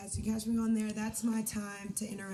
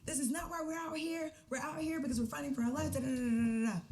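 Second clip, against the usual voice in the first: retold rant; 7 semitones higher than usual. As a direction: replay the outburst lightly, pitched up. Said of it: "I'm kinda like".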